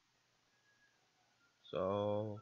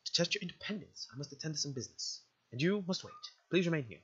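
formal, caring guardian voice; lilting, politely reassuring guardian; motherly game guardian; 2 semitones higher, 6 dB louder, faster than usual. Acted. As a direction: explain patiently like a proper storybook mother.

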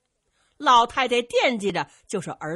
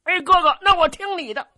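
higher, loud eager reply; eager simpleton agreeing; 9 semitones higher, 9 dB louder than usual. Acted. Zhu Can agrees to obey Zhang Delu, eager high voice. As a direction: agree with big-hearted eagerness.

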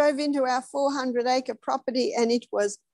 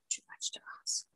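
soft whispered echo of the phrase; 17 dB quieter than usual. Shown as a demonstration. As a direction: breathe it out as a whisper. Repeated phrase, then whispered.

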